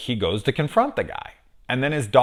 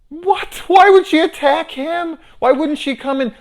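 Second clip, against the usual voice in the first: high, loud incredulous squeal; outraged disbelief; 9 dB louder than usual. Real, not acted. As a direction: scream your disbelief at the screen.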